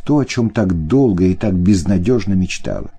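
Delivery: ordinary delivery, nothing marked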